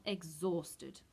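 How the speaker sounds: ordinary delivery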